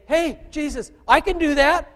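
high, loud eager volunteer; eager follower. Retold or imitated. Followers telling Jesus they can do it, eager and high. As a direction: burst out like a keen disciple. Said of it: "then the followers say".

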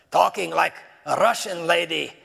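a rough mock Russian accent, lightly done; Russian lady accent. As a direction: put on a hint of a heavy Russian lady accent. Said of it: "She didn't talk like that".

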